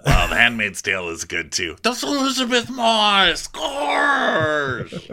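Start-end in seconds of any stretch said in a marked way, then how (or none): 1.8-5.1 high hammed punchline. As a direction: deliver the joke high.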